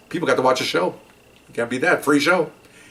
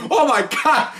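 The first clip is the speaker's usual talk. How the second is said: pitched way up in disbelief; stunned exclamation; 14 semitones higher, slower than usual. Retold or imitated. Partner realises the car is not backup, stunned and high. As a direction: gasp it out as it sinks in.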